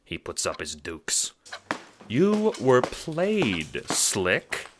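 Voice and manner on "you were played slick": calm, unhurried, faintly smug; slow, mild taunt; infuriatingly mild. Acted. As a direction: purr it slowly as if nothing could ruffle you. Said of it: "with that same infuriating mildness".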